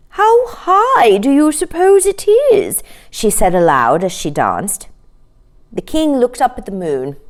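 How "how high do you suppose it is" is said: cow's voice. A narrator's reading. Red cow asks while dancing.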